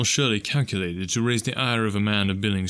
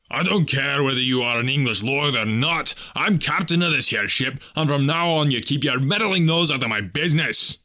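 louder, higher enraged captain; bellowing; 5 semitones higher, 4 dB louder than usual. Acted. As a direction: shout him down in a fury. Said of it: "he shrieked the last words at the top of his voice".

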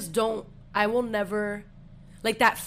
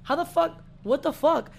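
higher-pitched, incredulous outburst; replaying stunned disbelief in a higher voice; retold disbelief; 8 semitones higher than usual. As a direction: act out your baffled reaction, pitched up. Retells alarmed reaction to the texter.

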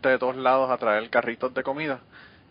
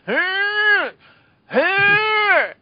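long, very high-pitched strained "eh" calls; loud high squeal like a street hawker straining; imitated vendor's cry; 6 dB louder than usual. Imitating the donut man pushing his cart, high shouted cry. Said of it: "empujando el carrito y gritaba".

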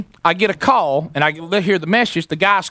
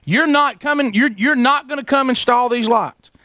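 high-pitched, indignant disbelief; unhappy wife; 8 semitones higher than usual. Retold or imitated. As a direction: imitate an upset wife challenging the installer. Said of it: "she's not happy".

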